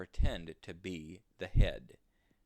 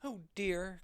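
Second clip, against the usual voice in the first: crying out; 12 semitones higher than usual. Acted. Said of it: "cried the little rabbits".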